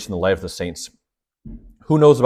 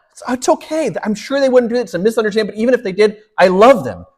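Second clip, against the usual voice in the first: serene priest; 10 semitones higher, 4 dB louder than usual. Retold or imitated. Saint gently brushing off complaints.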